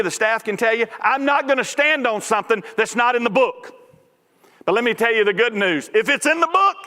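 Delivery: high, driving sermon delivery; emphatic pulpit voice, pitched high; full-force preaching. Real, not acted. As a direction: preach it with rising conviction.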